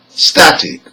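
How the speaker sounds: "static" stressed on STAT, weak ending; penultimate stress. Shown as a demonstration. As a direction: hit the first syllable of "static". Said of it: "second syllable from the end".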